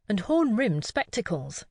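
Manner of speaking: ordinary delivery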